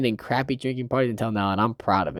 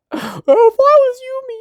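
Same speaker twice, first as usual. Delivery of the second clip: much higher, louder falsetto whine; mock Kazuya; 4 dB louder than usual. Mocking Kazuya whining about being Umi. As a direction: squeal it like a jealous boy.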